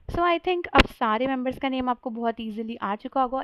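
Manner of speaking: ordinary delivery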